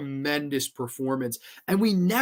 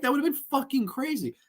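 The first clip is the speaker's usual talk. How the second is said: usual speaking voice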